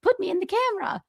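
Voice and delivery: high, fast mocking voice; mocking Kevin. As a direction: squeal it like a vain old man hamming for the camera. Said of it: "He's like".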